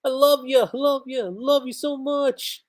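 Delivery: high, slow, gushing lovey-dovey voice; husband gushing over his wife; lovesick husband. Retold or imitated. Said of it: "he's like".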